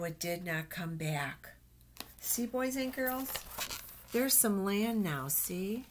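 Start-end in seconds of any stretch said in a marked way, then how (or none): none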